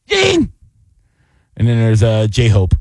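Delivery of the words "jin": Jin sung out on a high rising tone; mock Korean pronunciation. Shown as a demonstration. Host mocking Korean name pronunciation to cohost, high-pitched Jin. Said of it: "I'm saying Jin".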